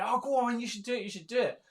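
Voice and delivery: pushing a friend to say yes; friend urging. Retold or imitated. Friend in Glasgow urging him to model, playful eager urging.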